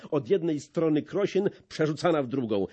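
usual speaking voice

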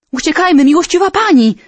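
high urgent plea; soldier's cry. Acted. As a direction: cry out to the princess to flee. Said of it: "zakrzyknął jeden ze zbrojnych".

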